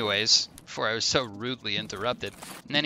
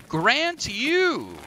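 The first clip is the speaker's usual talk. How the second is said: drawn-out, higher-pitched pronouncement; grand genie; 11 semitones higher than usual. Acted. Genie granting Jarvis a wish, stretched grandiose proclamation. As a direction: bestow it with theatrical pomp. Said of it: "the genie says".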